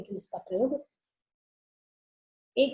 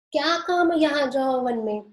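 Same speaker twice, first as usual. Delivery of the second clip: teasing Radha; 4 semitones higher, 5 dB louder than usual. Acted. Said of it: "Radha जानबूझकर उसका अर्थ अलग निकालती है".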